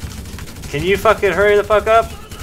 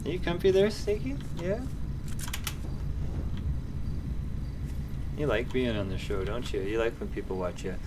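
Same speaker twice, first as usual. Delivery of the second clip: talking to pet; 7 dB quieter than usual. Real, not acted. Talking to the cat Sneaky.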